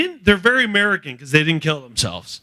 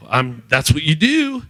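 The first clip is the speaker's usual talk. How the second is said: normal talking voice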